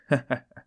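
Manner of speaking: short chuckle. A narrator's reading. Porphyry laughs.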